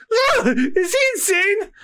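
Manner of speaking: high, slow wail with a laugh; mock panic; panicked cry. Panicked waiter reacts.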